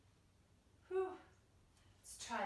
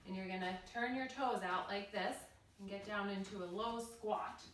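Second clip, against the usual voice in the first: usual speaking voice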